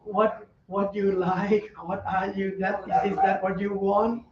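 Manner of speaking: slow, drawn-out nagging questions; lightly voiced, as mindfulness itself haunting you. Teacher voicing mindfulness haunting the student, slow and nagging. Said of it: "mindfulness is haunting you".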